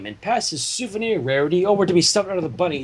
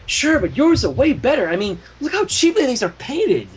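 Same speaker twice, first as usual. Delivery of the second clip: higher, quick reassurance; hasty Spike; 7 semitones higher than usual. Acted. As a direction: rush to smooth it over. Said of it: "hastened to say".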